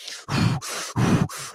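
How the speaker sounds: sharp blown-out breaths; hard puffing. Acted. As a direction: puff hard like a racing skater. Acting out Kakko racing up ice.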